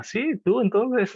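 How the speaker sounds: high, slow, loud drawn-out reply; mock amused "Sí, tú entonces" way up high and slow; amused Chinese friend. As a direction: stretch it out high like a friend happily agreeing. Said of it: "el chino le decía".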